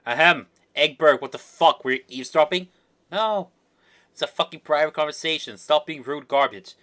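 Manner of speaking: loud, affronted telling-off; acted outrage at being overheard; indignant scolding. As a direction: scold the eavesdropper, voice pushed up.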